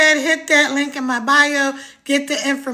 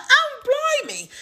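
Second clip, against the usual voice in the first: high, drawn-out British exclamation; put-on British accent; 12 semitones higher, slower than usual. Livestreamer answering a UK celebrity, mock British accent. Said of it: "putting on my British accent".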